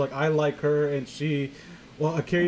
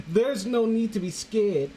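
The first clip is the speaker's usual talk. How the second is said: higher, slow creepy reassurance; slow confiding Jeff; 6 semitones higher, slower than usual. Shown as a demonstration. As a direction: croon it like a creepy boy soothing her. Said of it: "Jeff is confiding in her".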